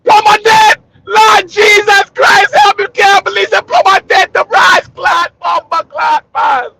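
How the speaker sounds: very high, loud Jamaican wail; wailing Jamaican lament. Retold or imitated. The worker mourning the plumber, hammed-up Jamaican wailing. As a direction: scream to heaven, hammed-up grief. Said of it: "He said".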